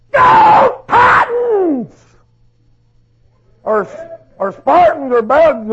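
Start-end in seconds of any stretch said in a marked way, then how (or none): 0.1-1.9 stretched, high, loud cheer "Go Titans!"; cheering shout. Retold or imitated. Preacher mocks a football crowd with a drawn-out loud cheer. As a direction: yell it slowly like a fan in the stands. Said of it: "Or it sounds like this".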